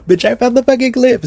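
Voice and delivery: high-pitched excited announcement; triumphant. Real, not acted. Finds the clip she searched for.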